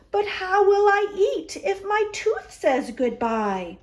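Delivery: rising, tearful plea from Bear; a touch of worry as Bear fears losing his tooth; worried Bear. Acted. Bear frets to his friends, mildly tearful. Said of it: "Bear frowned and he worried. Tears welled".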